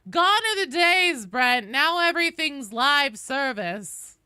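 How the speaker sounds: drawn-out, hammed-up nostalgia; mock lament. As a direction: declaim it like a grand lament for better times. Mock lament about modern games.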